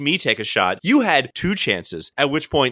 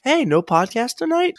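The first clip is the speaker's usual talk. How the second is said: imagined listener text; 12 semitones higher than usual. Retold or imitated. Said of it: "nobody texted me like".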